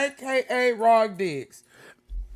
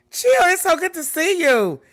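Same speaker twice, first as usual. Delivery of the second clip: high, loud happy greeting; excited greeting; 11 semitones higher, 9 dB louder than usual. Real, not acted. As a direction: beam at your viewers.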